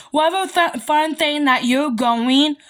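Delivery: usual speaking voice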